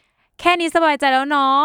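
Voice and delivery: slow sentence ending with a long, trailing เนาะ; drawn-out เนาะ ending. Shown as a demonstration. Showing the host her own habit, a slow trailing เนาะ. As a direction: let the final เนาะ hang and trail off. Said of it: "อย่างเช่นแบบ".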